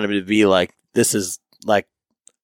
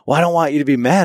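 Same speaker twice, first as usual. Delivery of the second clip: put-on higher and fast, anxious child; mock little daughter; 6 semitones higher, much faster than usual. Father quoting daughter afraid he'll be mad, fast high child voice. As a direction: rush it like a worried little girl.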